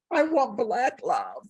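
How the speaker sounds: slow heartfelt quote. Retold or imitated. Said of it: "And she gets to the point where she says".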